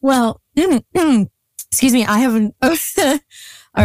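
catching breath after laughter, slowly; after laughing hard. Real, not acted. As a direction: pull yourself together after a laughing fit. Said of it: "I'm literally crying, laughing".

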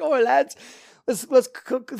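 high, loud Cockney call; Cockney accent. Retold or imitated. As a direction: do the Cockney penguin. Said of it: "like, a Cockney accent".

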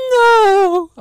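high, loud drawn-out no; anguished scream. Retold or imitated. Girl screaming as her boyfriend is killed, a hammed-up cry of horror.

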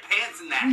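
"Pants and That" pitched high, said the Pittsburgh way; local Pittsburgh pronunciation. Shown as a demonstration. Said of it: "You gotta say it right".

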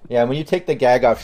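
ordinary delivery

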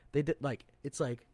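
ordinary delivery

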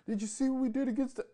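very high, loud squeaky mock fan; mocking bandwagon fan. Streamer mocks new Vikings fans bragging, squeaky hammed-up mockery. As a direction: ham up a gushing new fan. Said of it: "But don't give me regular season".

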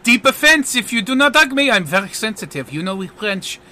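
put-on French-accented pleading that starts high and settles; theatrical French mock offense; French magician, playfully offended. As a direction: threaten to sulk like a sensitive French artiste. French magician demands a hug.

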